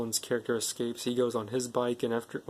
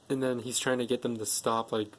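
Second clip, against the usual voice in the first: ordinary talk, nothing marked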